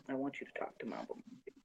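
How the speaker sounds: hushed, quickened whisper. Real, not acted. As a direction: whisper it like a confidential aside. Confidential repeat of the request.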